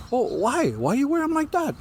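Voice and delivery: high incredulous auntie voice; teasing auntie. Retold or imitated. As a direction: needle her about the kimono. Retold Hawaiian auntie's teasing question.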